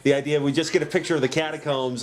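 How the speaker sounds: ordinary talk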